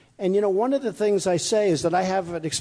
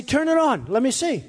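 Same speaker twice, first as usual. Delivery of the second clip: eager command; 6 semitones higher than usual. Retold or imitated. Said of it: "I say".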